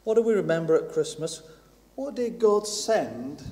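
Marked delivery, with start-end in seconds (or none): none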